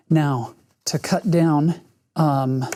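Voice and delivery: usual speaking voice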